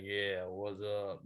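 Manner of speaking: playing the gangster part with a put-on deep voice; imitating his old tough-guy voice. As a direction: drop the voice low and drawl it like a young thug. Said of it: "I'd talk deeper".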